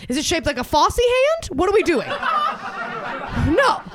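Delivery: high, loud comic outburst; hammed-up shouted protest, pitched high; exasperated mock rant. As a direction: build to an indignant shout. Climax of retort to Carol.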